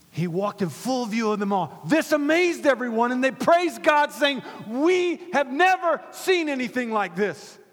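loud, high proclamation; triumphant preacher's voice; impassioned scripture reading. Real, not acted. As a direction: declaim the verse to the congregation. Preaching scripture at full force.

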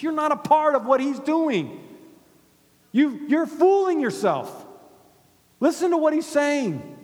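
loud and high, hammering the point; preaching at full force. Acted. Pastor calling out the church crowd, loud preaching. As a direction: thunder it at the congregation like a revival preacher.

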